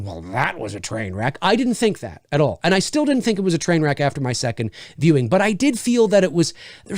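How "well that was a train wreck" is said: low, quiet mock grumble; mock verdict. Critic leaving the theater, muttered mock verdict. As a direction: mutter it like a let-down moviegoer. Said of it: "walk out of the theater going".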